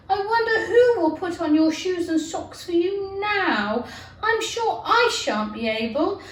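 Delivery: put-on a young girl fussing over her own feet; Alice, girlish and fond. Alice voiced with higher pitch.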